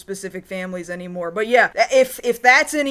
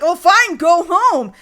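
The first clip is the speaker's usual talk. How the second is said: higher, louder mock yell; 6 semitones higher, 5 dB louder than usual. Kimiko yelling at Ryo, higher louder mock yell. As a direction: shoo him off. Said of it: "she's yelling at Ryo".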